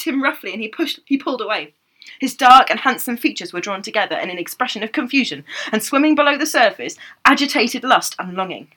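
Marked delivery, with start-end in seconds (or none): none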